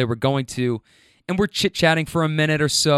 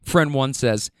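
usual speaking voice

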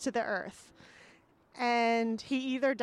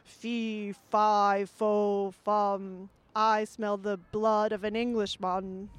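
lightly voiced storybook giant; a touch of giant menace; hint of a giant; slower than usual. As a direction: chant it deliberately, like a mild ogre. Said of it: "a giant who senses".

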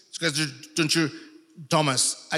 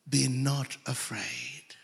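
slow hush on "Be not afraid"; a slow soft whisper; whispered reassurance; 9 dB quieter, slower than usual. Retold or imitated. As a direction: whisper it gently like comfort. Quoting Jesus softly.

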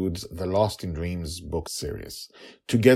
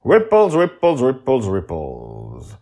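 dramatic echo; 5 semitones higher, 8 dB louder, slower than usual. Shown as a demonstration. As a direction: let the word fade like ripples. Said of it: "direct ripple of things".

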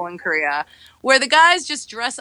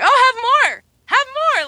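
high, loud teasing push to eat more; sadistic; 14 semitones higher, 6 dB louder, slower than usual. Retold or imitated. Friend's dad teasing her to eat, sadistic high coaxing. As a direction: gleefully force more food on her. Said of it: "Like, sadistic".

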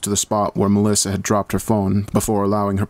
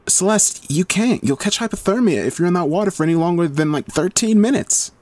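much higher, urgent objection; arguing Heather; 10 semitones higher than usual. A narrator's reading. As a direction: plead with her partner not to go. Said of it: "Heather argued".

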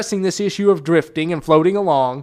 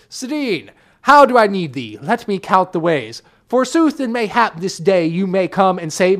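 hammy stage actor; 2 semitones higher, 3 dB louder than usual. Retold or imitated. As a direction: ham it up like a desperate tragic hero. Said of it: "So that's a long drawn out version of".

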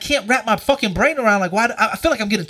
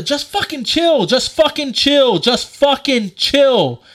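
high, louder, slow repeated demand; drawn-out insistent mock outburst; retold exasperated command; 6 semitones higher, 4 dB louder, slower than usual. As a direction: re-enact telling her off, stretching it out. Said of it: "So I gave her the".

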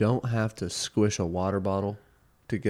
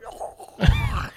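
gasping squeal; 4 dB louder than usual. Pretend. Imitated gasp after cold water.

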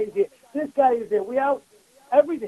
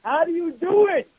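higher, lightly voiced curious kid; child's question; 7 semitones higher than usual. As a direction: ask it like his ten-year-old. My ten-year-old was telling me.

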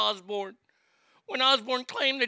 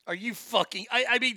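ordinary talk, nothing marked

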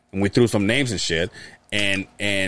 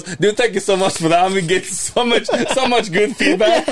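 laughing; 12 semitones higher, 3 dB louder than usual. Retold or imitated. Quoting grateful friend, laughing.

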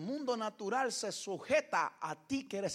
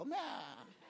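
mock bleating goat amen, softer; old goat; 7 dB quieter than usual. Preacher mocking a weak amen as an old goat bleating. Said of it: "Parece un chivo viejo".

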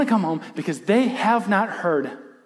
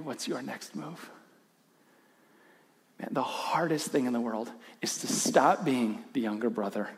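softer, hushed, intimate appeal; quiet earnest preaching; 6 semitones lower, 9 dB quieter than usual. Real, not acted. Preacher appeals softly to listeners about their next move. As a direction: lean in and speak it gently to the room.

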